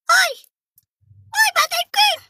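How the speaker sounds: very high, squeezed hailing; pinched squeaky voice. Pretend. Host hailing a viewer, pinched squeaky voice. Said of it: "Ipit na rin yung boses ko".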